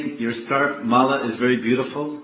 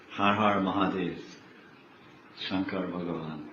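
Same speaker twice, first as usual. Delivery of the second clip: much softer, lower reverent address; bow inwardly and murmur his names; devoted murmur; 7 semitones lower, 9 dB quieter than usual. Retold or imitated. Parvati devotedly addresses Shiva.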